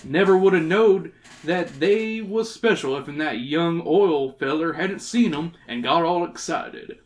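put-on folksy old prospector telling his tale. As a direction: spin it out like a rustic old-timer. Reads old prospector's dialogue.

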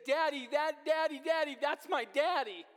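put-on high, loud child's cry of joy; little girl shouting. Father voicing his little daughter spotting him in the crowd, high excited shout. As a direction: call out like a small girl spotting her dad. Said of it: "her shouting something".

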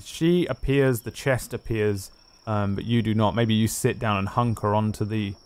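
ordinary delivery

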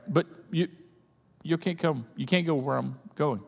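Jesus quietly refusing his friends; soft firm refusal. Retold or imitated. Jesus gently telling them.

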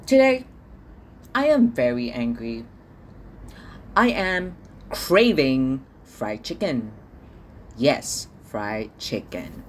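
usual speaking voice

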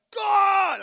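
sudden high shout at God; yelling at God. Retold or imitated. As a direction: yell his name out in full anger. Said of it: "I'm just, like, yelling at God".

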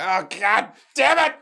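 high, loud mock outburst; annoyed waking groan. Camper woken by the sun, mock angry outburst. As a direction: curse the sunrise in mock rage. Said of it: "You're just trying to say".

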